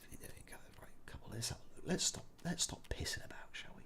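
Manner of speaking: breathy sneaking whisper. Acted. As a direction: hush it like you're creeping past guards. Sneaking through the game level.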